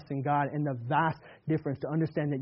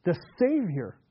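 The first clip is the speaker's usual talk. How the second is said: louder, slow, weighty rebuke; rebuking criminal; 5 semitones higher, 6 dB louder, slower than usual. Acted. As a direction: hammer home that they deserve it. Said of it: "This criminal is saying".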